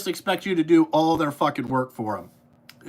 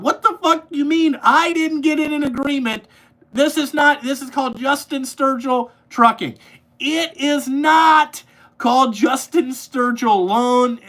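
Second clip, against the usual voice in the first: loud, high, furious outburst; high-pitched indignant shouting; angry rant; 6 semitones higher, 4 dB louder than usual. Real, not acted. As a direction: yell it at deadbeat clients. Angry rant at late payers.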